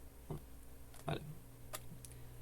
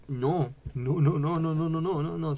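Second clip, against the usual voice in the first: emphatic no; 5 semitones higher, 6 dB louder than usual. Real, not acted. Mock refusal to Saussure.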